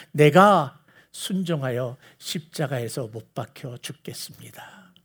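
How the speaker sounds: low, slow, near-whispered vow; hushed solemn vow. Retold or imitated. Jesus pledging the cross to the Father, hushed and solemn. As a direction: sink into a soft, slow pledge. Jesus vowing obedience, acted.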